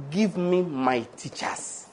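slow, soft petition; earnest prayer. Acted. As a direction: pray it quietly, drawing out each word. Acting out a prayer to God.

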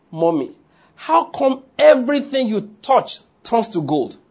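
high, slow, drawn-out wonder; amazed son. Retold or imitated. Son phones mother with amazed news.

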